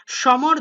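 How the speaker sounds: সমর said as shomor with sh; সমর with a sh at the start; teacher calls out সমর as shomor; pronunciation demo. Shown as a demonstration. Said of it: "দন্ত স উচ্চারণ করবো তালব্য শ মতো".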